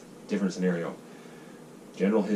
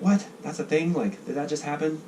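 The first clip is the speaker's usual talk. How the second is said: high, incredulous voice; a mildly hammed-up baffled newcomer; retold disbelief; 8 semitones higher than usual. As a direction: replay the shock of it. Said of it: "I was like".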